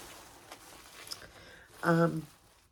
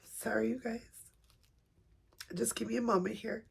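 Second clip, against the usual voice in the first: higher, soft tearful apology; holding back tears; 4 semitones higher, 5 dB quieter than usual. Real, not acted. Apologising to viewers while unboxing the baby, holding back tears. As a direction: choke back real tears, voice high and small. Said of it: "don't wanna be crying".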